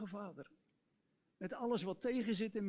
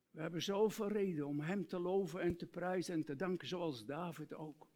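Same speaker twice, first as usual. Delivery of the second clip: ordinary talk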